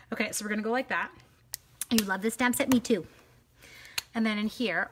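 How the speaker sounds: ordinary talk, nothing marked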